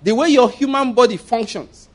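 preacher rebuking unbelief, rising and forceful; fervent preaching. Real, not acted. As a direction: preach it hard, driving home the proof. Preaching builds to full force.

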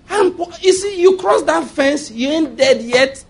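high, slow teasing challenge; mock taunt. Preacher taunting witches at his fence, slow high taunt.

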